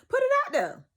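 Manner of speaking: high, loud punch on the phrase; excited call. Acted. To viewers, urging them to share, excited high call. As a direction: sing it out like a preacher's amen.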